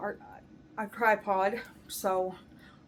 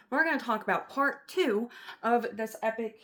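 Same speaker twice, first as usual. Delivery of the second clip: ordinary delivery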